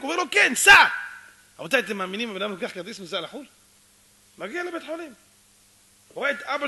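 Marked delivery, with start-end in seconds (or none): 0.3-0.8 high, very loud go-now order; acted emphatic shout; shouted command. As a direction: yell it to end all doubt. Stranger shouts at son to go.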